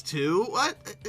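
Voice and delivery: ordinary delivery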